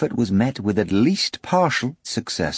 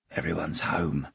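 whispered; 4 semitones lower, 9 dB quieter than usual. A narrator's reading. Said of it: "whispered one of the plumbers".

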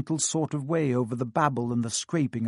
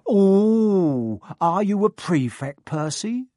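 mock surprise; 6 semitones higher, 6 dB louder, slower than usual. A narrator's reading. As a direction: fake amazement. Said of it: "with an air of great surprise".

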